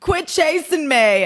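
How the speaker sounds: high, drawn-out reality star whine; slow, high, put-upon plea; Danielle Staub. Retold or imitated. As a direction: stretch it out like a fed-up reality star. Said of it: "The crow was Danielle Staub".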